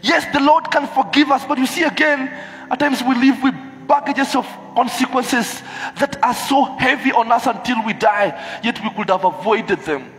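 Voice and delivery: fervent high loud sermon; preaching. Acted. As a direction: preach it out with full force. Preaching at full force.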